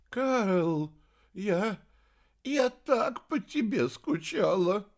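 high, drawn-out, choked-up confession; tearful longing. A narrator's reading. Old sorceress to her lost son, tearful longing. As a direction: let the longing lift the voice. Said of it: "на глазах старой волшебницы выступили слёзы".

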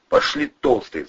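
mildly hissed command; hissed order. A narrator's reading. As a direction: hiss it at a captive. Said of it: "зашипел Бандура".

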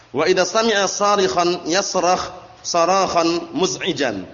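slow, drawn-out recitation; slow Arabic kitab reading; reading Arabic text. Shown as a demonstration. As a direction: read the Arabic line carefully and slowly. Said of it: "reading Arabic text aloud".

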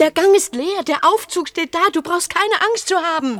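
high, loud forceful urging; urgent shout. Acted. Loudly urging a frightened partner to go to the lift. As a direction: drive them on, almost yelling.

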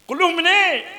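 high, loud quiz to the class; loud prompting question. Real, not acted. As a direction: call out the question to students.

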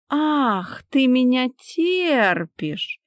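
high, drawn-out mock amazement; ironic drawl. A narrator's reading. Wife mocks husband's words, ironic high drawl. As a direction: stretch the words with irony. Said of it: "иронично протянула она".

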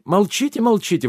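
high-pitched urgent exclamation; восклицание. A narrator's reading. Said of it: "воскликнул барон". (высоко восклицая, перебивая)

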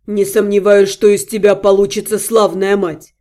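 low, fading far-off voice; like an echo from far away; distant echo. A narrator's reading. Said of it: "далёкое эхо его прощальных слов".

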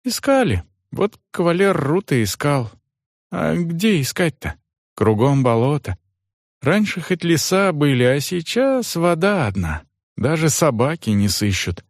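servant Johan. Acted.

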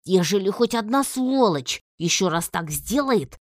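furious warning, pushed up in pitch and volume; angry. A narrator's reading. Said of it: "мой гневный монолог".

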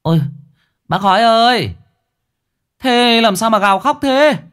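high, loud call across the road; a villager hailing the wailing man; calling passer-by. A narrator's reading. A passer-by calls to the wailing owner, high and loud.

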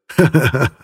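strained laugh from the group; forced laugh. A narrator's reading. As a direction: laugh along weakly. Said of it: "отозвались остальные". (вымученный смех)

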